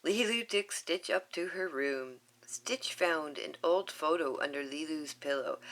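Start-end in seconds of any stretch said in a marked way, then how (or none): none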